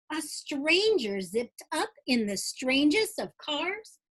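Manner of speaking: lively rhyming storybook read-aloud; bouncy Dr. Seuss-style read-aloud; reading to children. Acted. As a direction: read it like bedtime story time for kids. Reads picture book aloud.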